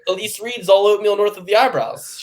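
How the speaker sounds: mock hype quote. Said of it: "mocking the drop quote".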